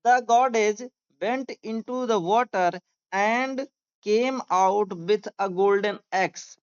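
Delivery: very slow, word by word dictation; slow classroom reading aloud. Shown as a demonstration. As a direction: read it out slowly for students to follow. Teacher dictating slowly.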